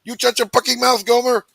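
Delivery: high, loud cursing instructor; drill instructor shout. Retold or imitated. Drill instructor screaming at recruits on the yellow line, high and loud. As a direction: yell it up high like an angry little drill instructor.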